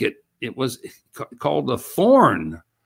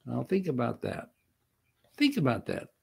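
much softer, inviting thought; quiet reflection; 3 semitones lower, 8 dB quieter than usual. Real, not acted. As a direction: drop the voice and let it sink in.

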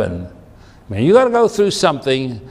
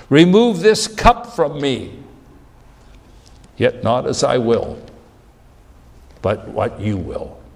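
a pleading son who gives in at the end; anguished prayer; 4 semitones higher than usual. Acted. Jesus praying in Gethsemane.

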